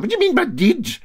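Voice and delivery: high shocked yelp; shocked cry. Acted. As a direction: cry out in disbelief. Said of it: "cried the Brodick man".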